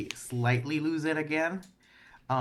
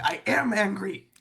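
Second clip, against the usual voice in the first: higher, sharp snap of I am angry; snapping retort; 8 semitones higher, 3 dB louder than usual. Acted. Player snaps aloud at her friend's warning. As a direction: bite back at the message. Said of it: "like, snap".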